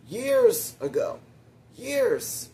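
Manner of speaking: YEARS stretched high and loud; YEARS punched way up, then falling; exasperated emphasis. Real, not acted. Stresses how long ago it was.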